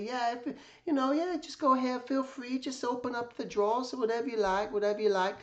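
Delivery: high-pitched gentle old-man impression; imitating Jack Davis. Narrator imitates Jack Davis inviting him to open the drawers, high kindly voice. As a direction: lift the voice way up, kind and easygoing. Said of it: "and it just talked like this".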